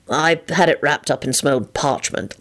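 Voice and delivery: mildly sheepish admission. A narrator's reading. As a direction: admit it a little abashed. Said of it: "offered up sheepishly".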